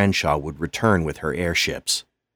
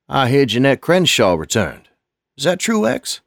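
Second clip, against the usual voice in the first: put-on higher, louder worried old man; 8 semitones higher, 5 dB louder than usual. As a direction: ask anxiously, rising high. Lonnie asks anxiously.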